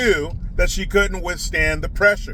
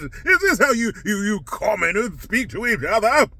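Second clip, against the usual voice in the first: snooty elite voice; 3 semitones higher than usual. Pretend. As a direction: ham up a snob discovering commoners. Mocking elite meeting commoners.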